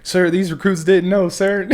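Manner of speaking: nervous recruit. Retold or imitated. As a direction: squeak out a frightened "sir". Recruit Lorenzoni voiced.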